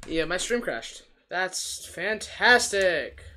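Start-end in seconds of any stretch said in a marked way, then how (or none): 1.3-3.2 sarcastic. Real, not acted. Stream crashed.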